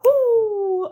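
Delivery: loud high whoop of delight; excited whoop. Real, not acted. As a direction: cheer it out. Cheers as race starts.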